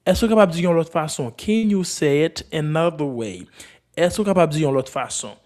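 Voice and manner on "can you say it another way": slower, evenly spaced model question; slow repeat. Shown as a demonstration. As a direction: repeat it slowly for learners.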